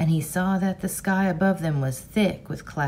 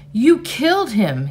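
loud, higher, rising anger; angry accusation; 5 semitones higher, 7 dB louder than usual. Acted. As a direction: accuse your father. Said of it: "an anger as big and powerful".

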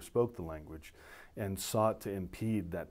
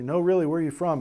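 louder, higher incredulous question; amused disbelief; 7 semitones higher, 8 dB louder, faster than usual. Retold or imitated. Laughing staff asking the officer where he's from, amused disbelief. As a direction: press for the real answer, imitating amazed listeners.

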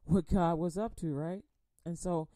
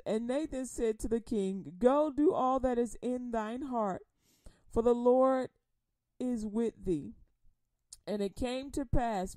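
scripture reading; 2 semitones higher, 4 dB louder than usual. Acted. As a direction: read it like the pulpit Bible. Rereading scripture.

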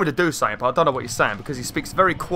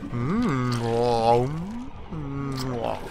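slow, drawn-out monster voice; hungry creature; much slower than usual. Acted. Creature eyeing the player as a tasty meal, slow monster voice. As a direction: stretch every word out like a munching beast. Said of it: "it's gonna eat me".

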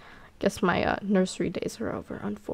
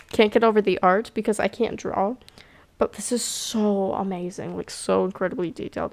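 normal talking voice

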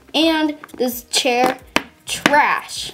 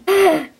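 shocked gasp; 6 semitones higher, 3 dB louder than usual. Acted. Teacher catches a lie.

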